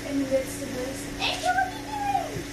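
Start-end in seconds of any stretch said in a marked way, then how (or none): 1.2-2.4 high sing-song voice for the puppy; high cooing puppy talk; pet voice. Real, not acted. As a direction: coo at the dog in a much higher voice. Talking to her puppy Angel.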